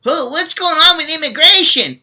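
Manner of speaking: high, mocking clueless whine; mocking the asker. Scolding the viewer by mimicking his question, high mocking whine. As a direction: ape the viewer's silly question. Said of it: "If you have to ask about".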